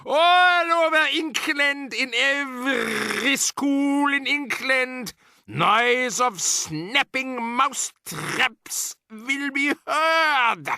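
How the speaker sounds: high, slow witch voice; a sinister foreign witch boss; Grand High Witch. Acted.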